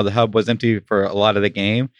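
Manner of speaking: usual speaking voice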